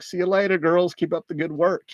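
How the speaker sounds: mock the heroes' cheerful send-off. Mocking farewell again.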